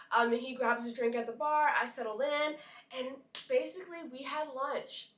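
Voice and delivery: usual speaking voice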